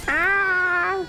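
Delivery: loud, high startled ah; sharp cry. Real, not acted. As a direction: cry out when struck. Sudden hit cry.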